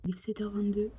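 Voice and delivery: soft unvoiced whisper; library whisper. Real, not acted. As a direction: whisper so nobody wakes up. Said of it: "Je parle tout bas". (chuchotement discret)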